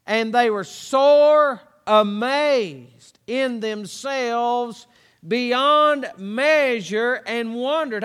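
very slow, weighty stretch on "sore amazed" and "beyond measure"; drawn-out scripture emphasis. Real, not acted. Preacher shows congregation the disciples' wonder, slow drawn-out emphasis on "sore amazed". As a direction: draw out "sore amazed in themselves beyond measure", pausing between phrases, "sore" punched loud.

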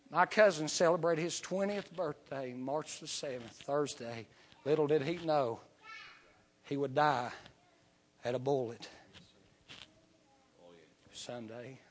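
preacher softening for a tragic warning; solemn hush. Acted. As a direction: let it drop to a grieving hush. Quiet solemn story of death.

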